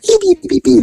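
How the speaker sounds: high stooge-style beeping noise; Shemp beeping. Retold or imitated. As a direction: beep like a Three Stooges gag. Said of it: "like Shemp of Three Stooges".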